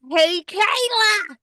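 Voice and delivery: high, slow mock call; imitated catchphrase yell. Host quoting a wrestler's catchphrase, high mock yell. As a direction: stretch the name out in a high imitated holler. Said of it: "just yelling".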